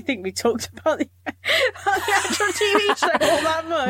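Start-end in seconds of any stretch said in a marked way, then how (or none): 1.2-3.9 laughing while saying it. Real, not acted. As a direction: giggle through the words. Admits they barely discussed show.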